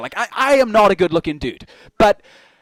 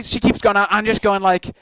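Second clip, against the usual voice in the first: ordinary talk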